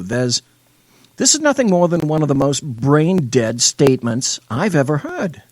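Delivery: normal talking voice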